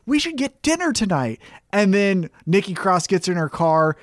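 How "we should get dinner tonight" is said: high, sweet fake-friendly voice; sweetly invite, hiding a trap; lightly voiced sugary Alexa Bliss; imitating Alexa Bliss. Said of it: "Alexa Bliss is like".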